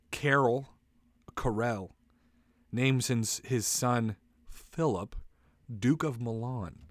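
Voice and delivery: normal talking voice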